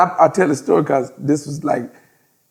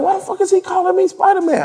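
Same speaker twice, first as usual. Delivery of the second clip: much higher, baffled imitation; confused friend. Friend puzzled at being called Spider-Man, high baffled voice. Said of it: "he looking like".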